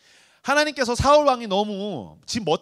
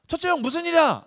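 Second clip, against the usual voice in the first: eager little brother; 9 semitones higher, 3 dB louder than usual. Retold or imitated. Youngest asking eldest brother.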